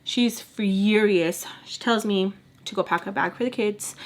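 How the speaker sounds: ordinary talk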